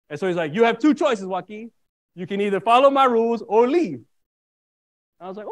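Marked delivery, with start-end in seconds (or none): 0.5-4.0 louder, higher, firmly stressed imitation of Dad; retold stern ultimatum. Dad gives his son two choices, retold stern ultimatum. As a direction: lay down the law as Dad.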